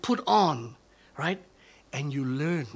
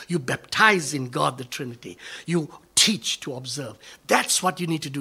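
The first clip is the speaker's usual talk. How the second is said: ordinary talk, nothing marked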